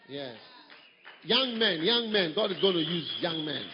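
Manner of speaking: ordinary talk, nothing marked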